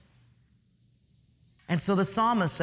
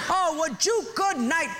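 pitched-up lazy couch husband calling out; preacher hams up a beer-belly husband; mock yelling husband; 10 semitones higher than usual. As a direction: holler from the armchair. Husband voiced in joke story.

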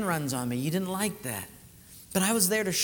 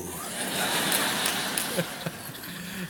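real laugh; 3 dB quieter than usual. Real, not acted. Laughing after the punchline.

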